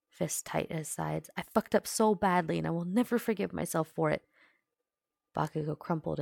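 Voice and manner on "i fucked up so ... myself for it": loud, anguished self-blame; yelling. A narrator's reading. Bakugo blames himself before Uraraka, yelling. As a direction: raise the voice and pour it out. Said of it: "he yelled".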